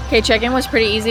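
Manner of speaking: normal talking voice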